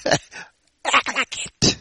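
soft, slow, intense near-whisper; hushed exhortation. Real, not acted. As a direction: drop to a drawn-out, hushed command. Same charge repeated in hushed voice.

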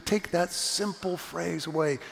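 ordinary delivery

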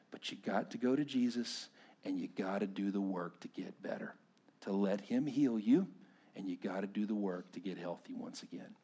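soft, low, earnest preaching; hushed pastoral appeal. Acted. Pastor urging the wounded to go to Jesus, hushed and earnest. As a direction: bring it down to a quiet, personal plea.

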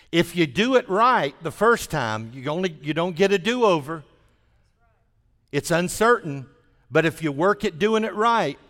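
loud, high, fervent preaching. Acted. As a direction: build to the climax and proclaim.

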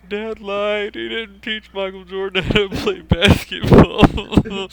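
high, loud, slow wailing; bawling kid. Retold or imitated. Crying boy telling his parents, wailing high and loud. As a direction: sob it out like a heartbroken six-year-old. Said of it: "the floodgates pour open".

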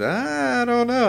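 very high, slow, drawn-out doubtful know; skeptical drawn-out know. Retold or imitated. Telling the coworker he doesn't buy it, high and drawn out. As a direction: stretch it out in disbelief.

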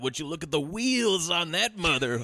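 a laughing, hammed-up Chicago accent. A Chicago accent mimicked while laughing. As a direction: drunk cop ogling legs, in a Chicago accent. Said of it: "that's how they talk".

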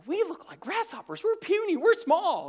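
high mocking voice of the scared spies; mock whiny spies. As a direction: squeak like tiny frightened men. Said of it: "They said".

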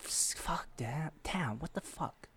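whispered curses in disbelief; shocked whisper. Retold or imitated. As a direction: whisper it like stunned in the cinema. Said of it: "but I was like".

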